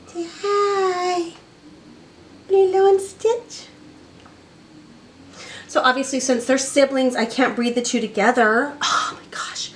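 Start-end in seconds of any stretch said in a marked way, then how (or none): 0.1-3.7 high, slow sing-song pet talk. Real, not acted. Talking to pets, baby talk. 8.8-9.7 whisper. Real, not acted. Whispered aside.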